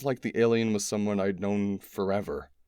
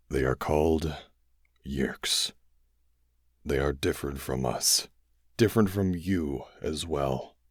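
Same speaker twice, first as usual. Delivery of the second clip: dying alien; 5 semitones lower than usual. A narrator's reading. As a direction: drag it out, grave and tired.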